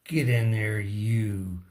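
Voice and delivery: ordinary talk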